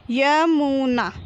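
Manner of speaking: slow, stretched य, मु, ना, falling at the end; drawn-out teaching reading of Yamuna; syllable by syllable. Shown as a demonstration. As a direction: sound out Yamuna piece by piece. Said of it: "तो हम इस प्रकार पढ़ेंगे".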